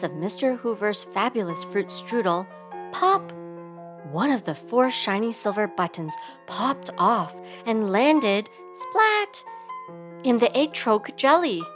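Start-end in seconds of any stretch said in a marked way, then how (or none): 2.9-3.3 high, sudden pop; popping sound word. A narrator's reading. Narrator tells of a button bursting off, high sudden pop. 8.7-9.4 high, punchy splat; splat sound word. A narrator's reading. Narrator tells of a button landing in jelly, high punchy splat.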